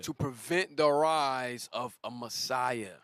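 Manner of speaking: slow, weighty repetition; slow emphasis. Real, not acted. Preacher repeats the goal for the congregation, slow and weighty. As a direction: let each word land, slowly.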